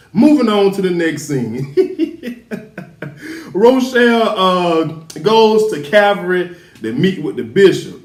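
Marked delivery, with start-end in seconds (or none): 2.5-3.5 quiet breathy giggle to oneself; soft chuckle. Real, not acted. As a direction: let out a small, soft laugh. Laughs after moving on.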